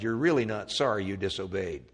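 ordinary talk, nothing marked

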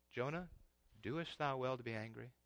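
gentle God. Acted. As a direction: call Jonah's name softly and ask. God's question repeated.

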